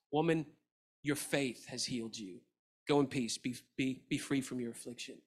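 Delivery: soft, low and gentle; Jesus tenderly sending the woman in peace; tender blessing. Retold or imitated. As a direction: speak peace over her quietly.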